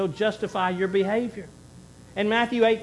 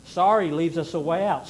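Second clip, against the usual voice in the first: ordinary talk, nothing marked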